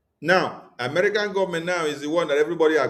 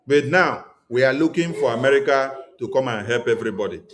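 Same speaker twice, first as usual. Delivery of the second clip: ordinary delivery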